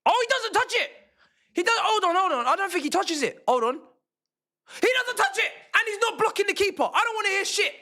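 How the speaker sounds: very high-pitched, frantic protest; outraged protest. Real, not acted. Fan insists the player didn't touch it, outraged yelling. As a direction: argue the call at the top of your range.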